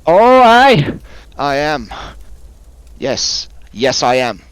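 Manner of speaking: put-on high old woman voice, flustered then proud; a fussy old ghost hunter introducing herself; old woman introducing herself to the traveler, high and flustered; old woman Agatha.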